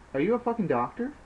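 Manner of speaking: high, fast, aggressive demand. Acted. Snake aggressively asks Jacob if he is a doctor, high and fast. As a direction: jab the question at him. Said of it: "points aggressively".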